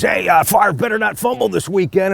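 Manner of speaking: high-pitched, acted-out call from a passing fan; passing stranger calling out. Passing stranger calls out in story.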